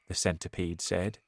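plain reading voice

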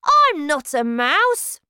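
high, loud, slow insistence; stubborn denial. Acted. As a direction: refuse to believe it. Bruno denying being a mouse.

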